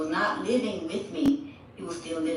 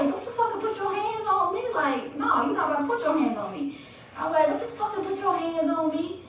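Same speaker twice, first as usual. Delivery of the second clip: much higher, heated retold warning; retold furious yell; 10 semitones higher than usual. To boyfriend after he hit her, high furious retold warning. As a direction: yell it high like the fight is happening again. Retold fight, hitting back.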